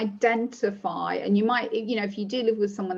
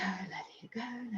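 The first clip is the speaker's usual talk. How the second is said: a soft repeated self-calming whisper; whispered mantra; 14 dB quieter than usual. Shown as a demonstration. Mother calming herself on FaceTime, whispered mantra. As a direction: whisper it under your breath. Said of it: "maybe you say".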